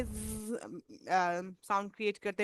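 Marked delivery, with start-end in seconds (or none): 0.0-0.5 held buzzing 'zzz'; bee buzz. Shown as a demonstration. Teacher imitating bees for Z, buzzing. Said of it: "जैसे बीज का sound होता है".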